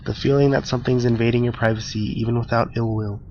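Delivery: ordinary talk, nothing marked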